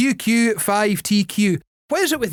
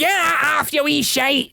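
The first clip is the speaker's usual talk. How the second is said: put-on shouted Ouija-accent mum, pitched up; 8 semitones higher, slower than usual. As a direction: yell it at the kid in a Ouija accent. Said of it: "In her strongest Ouija accent".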